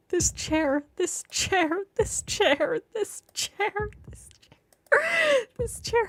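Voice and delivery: high, strained repetition; obsessive rant. A narrator's reading. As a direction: keep chanting it, increasingly unhinged.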